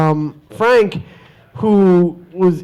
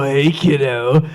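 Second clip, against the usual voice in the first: creepy kiddo; 5 semitones lower, slower than usual. Retold or imitated. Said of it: "I'm not saying go".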